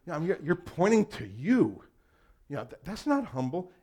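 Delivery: mock the listener who won't buy it; incredulous retort. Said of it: "You would go".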